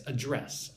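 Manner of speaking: "address" stressed on its second syllable; verb stress. Shown as a demonstration. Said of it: "if it's a verb".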